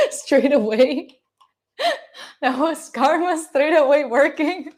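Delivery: talking through real laughter; laughing at instant karma. Real, not acted. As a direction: giggle the words out slowly. Laughing after tripping on cord.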